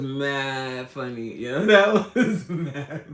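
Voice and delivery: loud, slow words through real laughter; real amused laughing speech; laughing hard. Real, not acted. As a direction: stretch the words out while cracking up. Recalling what cracked her up.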